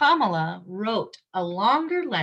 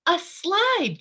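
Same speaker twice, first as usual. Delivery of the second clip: put-on eager child; 9 semitones higher than usual. Child character answers eagerly.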